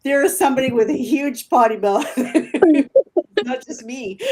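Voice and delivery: amused, talking through real laughter; laughing. Real, not acted. Joking about potty mouths, laughing.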